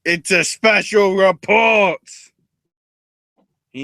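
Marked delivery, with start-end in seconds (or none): 0.1-2.0 loud, high, slow bulletin shout; hammed-up newsflash voice; mock news announcer. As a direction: announce a breaking report, drawn out and loud. Mock news network interruption.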